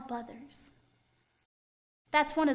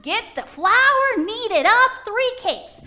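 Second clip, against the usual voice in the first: calling out; 5 semitones higher, 8 dB louder than usual. Acted. Abraham calling to Sarah to bake, loud and urgent. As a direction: call across the house with urgency.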